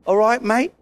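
put-on Australian greeting, rising at the end; Australian accent with an upward lilt. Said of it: "If he's Australian".

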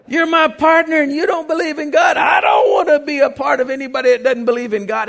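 high, loud, furious yelling; furious shout. Retold or imitated. Big man yelling furiously at his unbelieving friend. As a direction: rail on him at full voice.

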